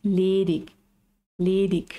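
"Ledig, ledig" each ending in a firm k; slow teacher repeat of "ledig" with k ending; final g as k. Shown as a demonstration. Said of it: "Suena más fuerte".

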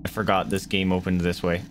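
normal talking voice

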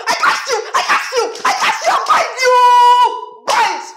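high, loud, frantic rebuking shouts; casting out devil. Acted.

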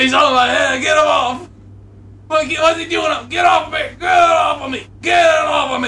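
high, loud frantic yelling; acted panic. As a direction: shout desperately to get it off. Squirrel is on his head.

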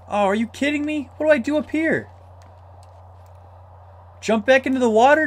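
high, incredulous; shocked disbelief. Real, not acted. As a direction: react in disbelief. Shocked at dead end.

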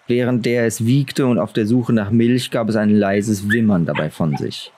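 ordinary delivery, nothing marked

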